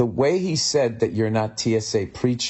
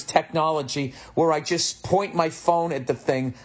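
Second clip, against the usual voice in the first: pitched-up exasperated comeback; exasperated rant; 8 semitones higher than usual. Retold or imitated. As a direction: fire back at a useless clerk, high-pitched. Imagined angry retort to clerk.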